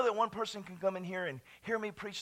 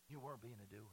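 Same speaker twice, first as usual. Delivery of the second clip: ordinary delivery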